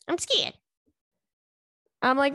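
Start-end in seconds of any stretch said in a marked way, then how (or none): 0.1-0.5 higher-pitched mock scared mom; imitating mom. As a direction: squeak it like a timid mother. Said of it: "She's like".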